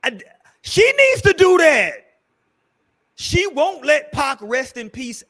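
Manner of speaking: loud, high, exasperated shout; heated outburst. Real, not acted. Heated outburst about her.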